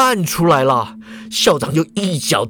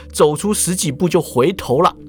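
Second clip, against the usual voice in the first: ordinary delivery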